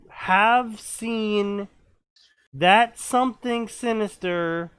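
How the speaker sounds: slow, deliberate storyteller; dramatic story reading. Acted. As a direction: read it out slowly with dramatic weight. Reads a story aloud.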